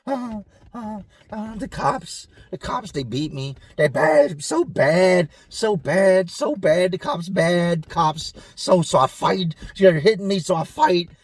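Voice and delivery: lightly voiced troubled cellmate. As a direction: stammer like the confused cellmate. Cellmate voiced answering question.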